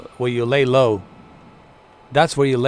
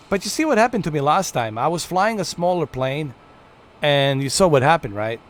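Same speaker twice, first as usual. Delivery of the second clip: ordinary talk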